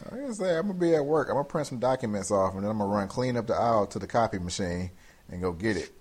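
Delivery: ordinary talk, nothing marked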